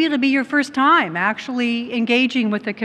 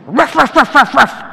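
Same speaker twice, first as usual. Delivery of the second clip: loud, punchy imitated dog barks; mock dog barking; 4 semitones higher, 7 dB louder than usual. Mother mouse barks at the cat, loud mock woofs. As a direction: bark like a dog to scare off a cat. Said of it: "looks that cat in the eye and goes".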